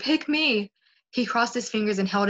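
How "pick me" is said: higher-pitched eager plea; imploring, pitched up; pleading groan. A narrator's reading. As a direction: beg to be chosen. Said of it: "Sam groaned".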